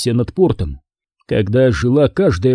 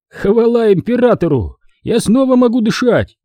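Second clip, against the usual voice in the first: higher, brighter relieved cry; relieved exclamation; 10 semitones higher than usual. Acted.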